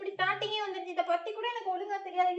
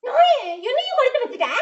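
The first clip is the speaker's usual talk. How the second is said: laughing yell; 4 semitones higher, 8 dB louder than usual. Real, not acted. Bitten, laughing loud.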